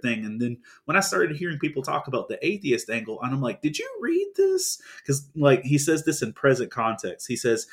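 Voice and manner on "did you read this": very high, stretched squeal of disbelief; mock incredulity. Co-host answering people who talk atheist angle, incredulous high squeal.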